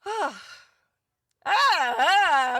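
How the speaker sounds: teary laugh, pitch jumping high; laughing through tears. Real, not acted. Host laughing, moved by fan comments, teary laugh. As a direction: laugh while fighting back tears. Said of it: "make me tear up".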